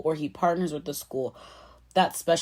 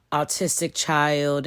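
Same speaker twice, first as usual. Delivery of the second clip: ordinary delivery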